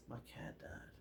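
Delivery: tiny whispered sad reply; hushed, imitated sorrow; mock sad. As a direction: whisper it like a heartbroken friend. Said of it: "and he's all sad".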